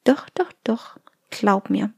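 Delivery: tender voice of the heart; gentle inner voice. Acted. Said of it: "Diese leise Stimme sagt".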